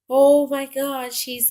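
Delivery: loud, slow burst of alarm, then it drops; recalled jolt of fear at the truck coming back; startled gasp. Retold or imitated. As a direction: blurt it out as the truck rolls back. Sees the truck return.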